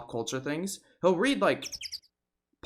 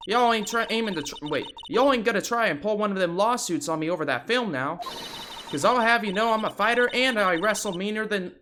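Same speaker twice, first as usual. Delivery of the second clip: defiant Southern woman, louder; Southern photographer; 8 semitones higher, 6 dB louder than usual. Acted. Photographer threatening the lawyer, defiant Southern accent.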